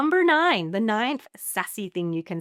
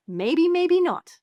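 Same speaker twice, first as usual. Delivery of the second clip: high, slow, loud "maybe, maybe not"; sing-song noncommittal tease; breezy shrug; 10 semitones higher, 7 dB louder, much slower than usual. Shown as a demonstration. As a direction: toss it off with a shrug. Sassy demo.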